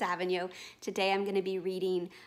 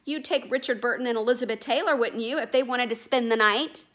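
loud, higher, pushy boatman; 5 semitones higher, 9 dB louder than usual. Acted. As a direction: corner the clerk with it. Boatman presses the clerk.

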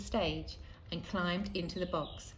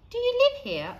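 put-on much higher, louder child's voice; wondering child; 9 dB louder than usual. A narrator's reading. Leon asks the boy in wonder, high child's voice. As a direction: ask it wide-eyed like a small boy.

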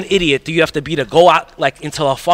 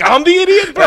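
high mock jockey voice; imitating jockey; 13 semitones higher, 5 dB louder than usual. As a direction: play the jockey, lightly hammed. Jockey voiced in retold story.

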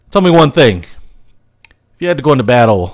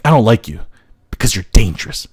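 ordinary delivery

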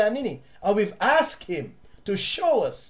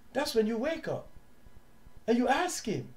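normal talking voice

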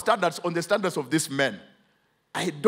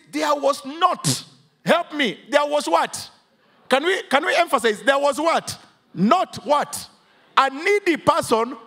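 full-force preaching; 6 semitones higher, slower than usual. Acted. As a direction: drive the verse home to the congregation.

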